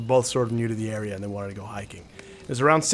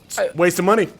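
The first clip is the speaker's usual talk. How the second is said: higher, louder dismissive dad; dad justifying; 6 semitones higher, 6 dB louder, faster than usual. Retold or imitated. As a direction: brush it off like a stubborn dad. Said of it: "He's like".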